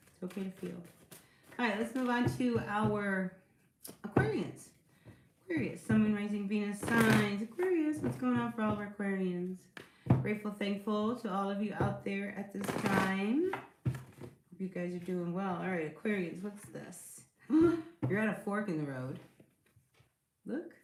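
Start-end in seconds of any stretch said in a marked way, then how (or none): 0.1-0.8 softer and gentler, nearly hushed; soft reassurance. Real, not acted. Tarot reader soothing anxious Capricorns, softly reassuring. 17.5-18.0 a loud, high surprised gasp; sharp gasp. Real, not acted. Reader reacting to the Aquarius card, surprised gasp.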